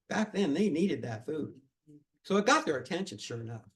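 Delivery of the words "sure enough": ordinary delivery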